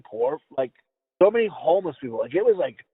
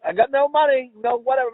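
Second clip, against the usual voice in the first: mock beggar's plea; 7 semitones higher, 3 dB louder than usual. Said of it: "you're like".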